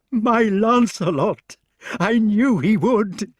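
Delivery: high, slow cry with a trembling voice. A narrator's reading. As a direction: cry out the name, voice shaking with joy. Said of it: "with trembling voice began to cry".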